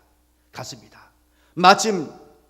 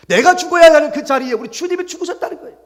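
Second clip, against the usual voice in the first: high, loud proclamation; full-force sermon climax; climactic preaching; 14 semitones higher, 10 dB louder than usual. Real, not acted. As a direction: belt it out to the congregation. Preacher's climactic statement of the gospel.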